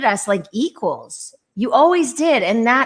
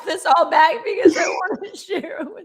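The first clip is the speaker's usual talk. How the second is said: crying tears of love; 4 semitones higher, faster than usual. Real, not acted. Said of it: "crying really ugly tears".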